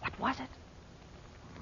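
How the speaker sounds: scared, slow whisper; frightened whisper. Acted. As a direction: breathe it out, afraid of being heard. Hearing a noise in the tomb.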